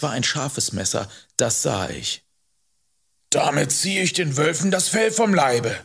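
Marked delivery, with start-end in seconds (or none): 3.3-5.7 lightly voiced angry man, higher; indignant rider. Man holds knife under boy's nose, indignantly. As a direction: explain it forcefully, still irritated.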